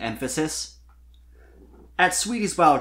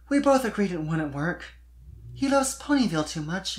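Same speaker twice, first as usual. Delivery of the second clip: put-on high, airy young filly voice, lifted well above the narrator; a gushing young pop star filly; Sweetie's voice; 5 semitones higher than usual. Sweetie complaining to Spike about fans, high filly voice.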